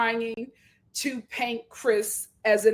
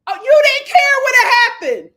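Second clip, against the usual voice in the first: mocking shout; 10 semitones higher, 8 dB louder than usual. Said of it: "I said".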